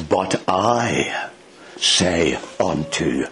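slow, low and solemn, word by word; low, deliberate and authoritative; note of authority. Acted. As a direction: pronounce it with quiet authority, pausing between words. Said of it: "The note of authority in his voice".

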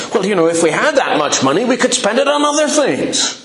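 irritated grumbling. Pretend. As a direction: grumble like fed-up disciples. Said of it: "You can almost hear them thinking".

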